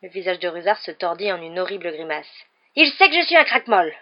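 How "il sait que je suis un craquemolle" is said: high, loud outburst; distraught raised-voice admission; anguished confession. A narrator's reading. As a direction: blurt out the shameful word loudly. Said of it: "acheva-t-il enfin".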